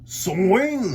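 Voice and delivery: father yelling at the plate; hollering. A narrator's reading. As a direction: holler it from the stands. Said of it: "hollered Papa".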